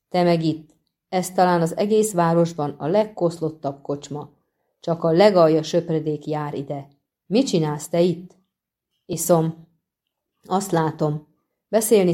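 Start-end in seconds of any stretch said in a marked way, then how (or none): none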